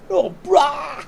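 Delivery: loud, high, hammed-up outpouring of sympathy; exaggerated mock lament; mock commiseration. Mimicking the sympathy being fished for.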